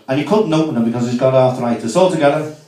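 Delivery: ordinary talk, nothing marked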